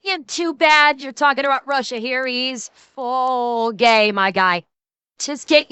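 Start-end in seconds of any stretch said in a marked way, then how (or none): none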